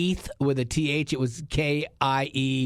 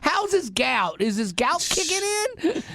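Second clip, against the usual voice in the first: high, slow Southern sweetness; sing-song Southern daughter; Southern accent; 14 semitones higher than usual. Retold or imitated. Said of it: "so Southern when you talk to your mom and dad".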